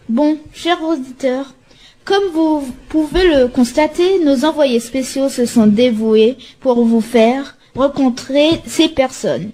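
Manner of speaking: usual speaking voice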